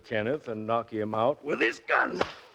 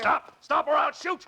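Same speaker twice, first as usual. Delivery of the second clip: shouted threat. Acted. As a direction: yell him to a halt. Gunman shouting at fleeing man.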